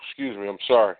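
ordinary delivery

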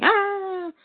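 high hammed-up yelp of pain; mock pain yelp. Villain hit by heat vision, a high mock yelp. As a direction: yelp as if scorched in the face.

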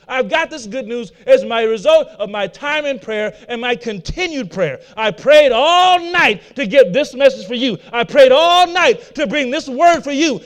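rising to a shout over "I prayed all night"; full-force preaching. Acted. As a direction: preach it out to the whole church.

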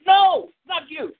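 high, slow put-on parent voice; mock parent. Parents refusing the child, slow high mock voice. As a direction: drag it out, teasing.

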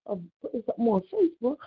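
firing back at an unfair accusation; retold indignation. Retelling how she answered Carol, fast and indignant. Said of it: "I was mad".

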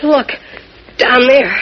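loud frightened pointing out; alarmed, look there; boy spots something scary; startled alarm. Acted. Jimmy spots a figure.

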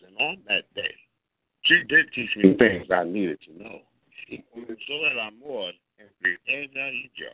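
storytelling verse, lightly performed; poem recitation. Acted. Poet reciting his love poem to the room, easy and rhythmic. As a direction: read it like a romantic poem.

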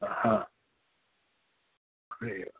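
normal talking voice

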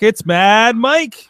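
slow, stretched-out announcer build; a host bringing on a guest with a slow, drawn-out build; drawn-out introduction. Acted. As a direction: drag out each word of the guest's name.

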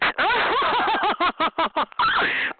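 high, rolling cackle; cackling laugh. Real, not acted. After the jack-o'-lantern insult, high cackle. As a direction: cackle at your own insult.

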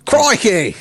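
high exclamation in a mock Australian accent; hammed-up Aussie catchphrase. Shown as a demonstration. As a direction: call it out like a cartoon Australian. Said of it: "give me your Australian accent then".

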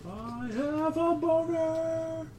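high, slow mock He-Man voice. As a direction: boom it out like a cartoon hero. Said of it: "It's like He-Man".

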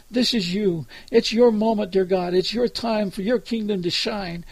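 ordinary talk

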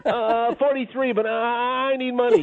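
high, whiny grown son; hammed-up pleading whine. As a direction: beg dad pitifully in a high voice. Plays the whining son answering.

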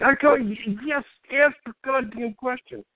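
high, slow, strained mock exasperation; exasperated reply. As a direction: drag it out as retold lost patience. Retelling exasperated reply to same question.

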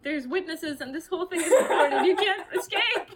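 mock outrage through laughter; giggling while quoting; laughing. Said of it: "I was just like".

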